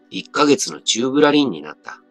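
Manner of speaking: ordinary delivery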